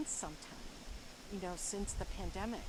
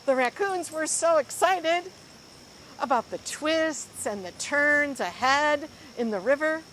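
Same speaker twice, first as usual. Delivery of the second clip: excited; 6 semitones higher, 13 dB louder than usual. Acted. Excited raccoons in story.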